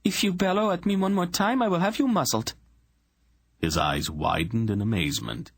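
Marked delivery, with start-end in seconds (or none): 0.0-2.5 much higher, cold and firm royal voice; a hint of steel in her words; steely queen. A narrator's reading. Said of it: "hint of steel in her voice".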